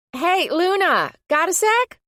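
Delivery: high, loud, drawn-out call. A narrator's reading. Rita calling across the atrium to Luna, high and loud. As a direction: call out to catch her attention. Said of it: "Rita from the Blonde Shallot call out".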